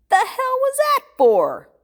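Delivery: very high, loud, drawn-out outburst; high-pitched incredulous shout; outraged disbelief. Acted. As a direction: yell it in exasperated shock. Shocked man confronts friend over blow.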